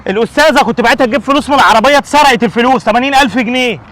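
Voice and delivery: loud, high, upset; agitated complaint. Acted. Father telling neighbour about the theft, agitated.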